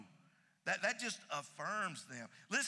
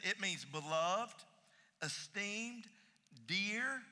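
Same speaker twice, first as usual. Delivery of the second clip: normal talking voice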